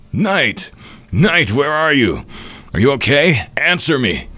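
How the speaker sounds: high, urgent calls for a missing friend; acted panic, pitched well up; frantic calling out. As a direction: call out desperately, voice lifted high.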